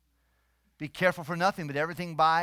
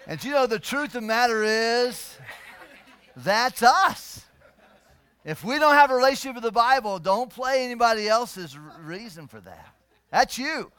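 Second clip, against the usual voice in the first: loud, raised-pitch sermon voice; full-force preaching; 6 semitones higher, 11 dB louder than usual. Real, not acted. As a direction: drive the point home to the congregation.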